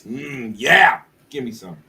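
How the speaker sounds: ordinary delivery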